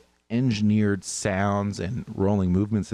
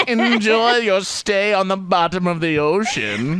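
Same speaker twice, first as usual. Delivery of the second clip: higher, louder, hammed-up proper British voice through a laugh; hammy British accent, laughing; 10 semitones higher, 5 dB louder than usual. Quoting another navy line, laughing in a hammy British accent. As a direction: gloat like a smug British officer while laughing. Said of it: "the proper British accent".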